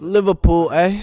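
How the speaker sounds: hammed-up British accent; mock British accent. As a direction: say it like a loud caricature Brit, dropping the R. Mock British bit on Liverpool.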